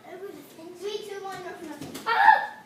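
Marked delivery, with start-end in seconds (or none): 2.1-2.6 high startled yelp; sudden yelp. Real, not acted. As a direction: yelp when hit. Hit by dart.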